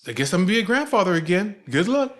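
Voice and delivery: loud, high mock indignation, rising at the end; pissed off. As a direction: imitate an exasperated grandfather-to-be. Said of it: "He'd get pissed off".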